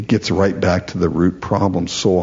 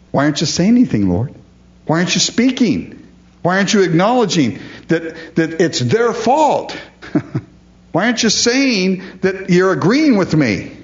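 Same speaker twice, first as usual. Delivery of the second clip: higher, whiny questioning of God; petulant complaint; 8 semitones higher than usual. Retold or imitated. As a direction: complain like a sulking child. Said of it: "we're saying".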